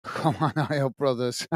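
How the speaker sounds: low, soft repeat through laughter; amused chuckling. Real, not acted. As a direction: chuckle quietly through the words. Amused rereading of chat message.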